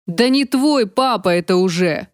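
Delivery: usual speaking voice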